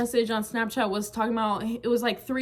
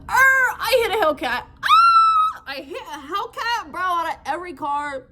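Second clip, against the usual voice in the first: high, loud, horrified at the crash; retelling backing into an expensive car, shocked and loud; panicked shock; 8 semitones higher, 6 dB louder than usual. Retold or imitated. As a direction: yelp it out as if you just hit a Hellcat. Crashes into a Hellcat.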